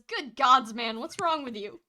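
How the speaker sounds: loud, startled outburst. Acted. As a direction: jolt back in shock. Startled character jolts back.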